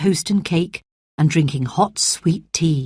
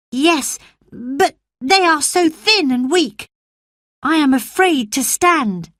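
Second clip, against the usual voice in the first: put-on timid sickly boy; 10 semitones higher than usual. Colin's voice, fearful.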